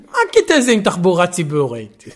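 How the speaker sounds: put-on laughing through a French accent; French accent in Hebrew. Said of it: "תגיד את זה במבטא צרפתי".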